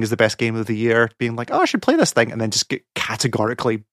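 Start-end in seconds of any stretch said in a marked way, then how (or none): 1.5-2.3 mock-eager, high-pitched quote; naive enthusiasm. Voicing players sold on game of the year, naive enthusiasm. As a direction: imitate a hyped newcomer in a much higher voice. Voices hyped would-be players.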